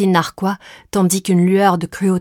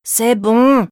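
put-on higher, louder fed-up child voice; sighing boy; 5 semitones higher, 5 dB louder than usual. A narrator's reading. Boy glued to his console, exasperated sigh. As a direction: give in with a put-upon groan. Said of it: "soupira-t-il".

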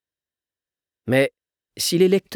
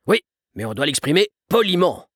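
mildly preachy, raised-pitch father; a touch of emphatic parental reproof; lecturing; 5 semitones higher, 3 dB louder than usual. A narrator's reading. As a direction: sermonize a child about manners. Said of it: "sermonna le père".

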